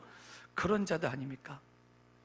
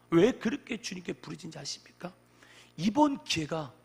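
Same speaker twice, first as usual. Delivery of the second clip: usual speaking voice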